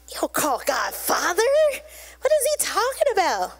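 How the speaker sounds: rising disbelief, jumping high in pitch; incredulous objection. Acted. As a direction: question it, climbing into a high-pitched protest. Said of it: "they're like".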